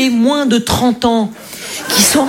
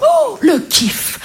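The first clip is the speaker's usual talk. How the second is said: excited; 11 semitones higher, much slower than usual. Retold or imitated. Childhood thrill at record.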